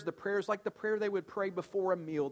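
normal talking voice

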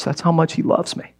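normal talking voice

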